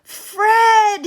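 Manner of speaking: very high, loud cry of the name; joyful cry. A narrator's reading. Boy cries out on seeing his dog, high and joyful. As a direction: greet the lost dog with delight. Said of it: "he cries".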